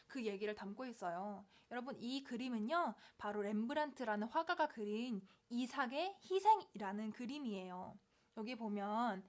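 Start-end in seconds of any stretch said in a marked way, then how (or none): none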